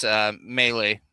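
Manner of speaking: ordinary delivery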